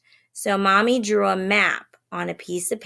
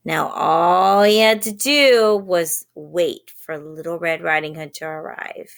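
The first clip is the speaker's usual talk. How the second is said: usual speaking voice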